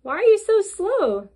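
high, loud monkey voice; howler monkey voice. Acted. Howler monkey asks the sloth.

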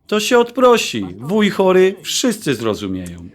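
high, airy brush-off; high, casual reassurance that it's no problem; breezy dismissal. Acted. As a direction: wave the problem away lightly.

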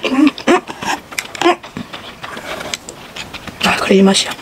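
humming while chewing food; speaking around mouthful of noodles; mouth full, chewing. Real, not acted. Seen 0: took noodles at -1, closed lips moving through humming.

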